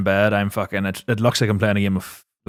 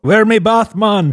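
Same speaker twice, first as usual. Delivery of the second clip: loud, high mock Jamaican accent; hammed-up Jamaican accent, loud and high; 10 semitones higher, 5 dB louder, slower than usual. Friend jokingly voicing a Jamaican hotel guest, loud mock Jamaican accent. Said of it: "speak to the Jamaican in the native tongue".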